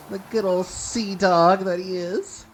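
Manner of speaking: slowed storyteller tone; slow, drawn-out. Acted. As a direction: stretch it out slowly. Introducing Willy.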